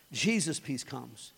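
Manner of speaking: ordinary delivery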